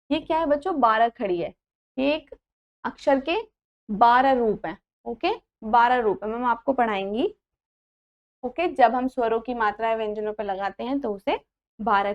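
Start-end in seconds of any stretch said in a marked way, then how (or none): none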